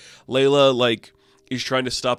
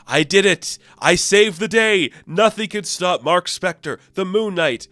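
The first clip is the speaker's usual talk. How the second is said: higher and louder, proud swagger; mock heroic boast; 7 semitones higher, 5 dB louder than usual. Vlogger mocking the book's heroic ending, hammed-up. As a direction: crow like a cartoon hero.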